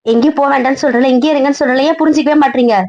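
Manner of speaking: high, fast, frustrated pleading outburst; exasperated outburst. Acted. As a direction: fire it off fast and high, fed up. Daughter snaps at nagging parent.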